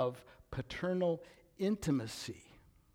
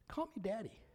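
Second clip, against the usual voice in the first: soft, high, intimate voice; gently invite, softly and lifted; tender invitation; 8 semitones higher, 7 dB quieter, faster than usual. Retold or imitated. Eternal King inviting his children close, tender and soft.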